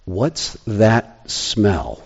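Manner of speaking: slow, soft, hushed question; worried. Retold or imitated. Todd noticing a smell, quiet and slow. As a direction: let the fun die into worry. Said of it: "when Todd said".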